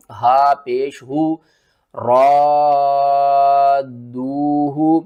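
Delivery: slow tajweed spelling. Shown as a demonstration. Teacher spelling the word, slow. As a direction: spell it slowly. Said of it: "ہجے کر کے سمجھاتا ہوں".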